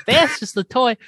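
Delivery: high, punched-up dismissal; emphatic retelling. Retold or imitated. Eddie grabs it from Herman to correct him, emphatic. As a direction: pitch it up as you grab the toy. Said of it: "He grabs it. He goes".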